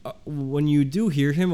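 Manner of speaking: normal talking voice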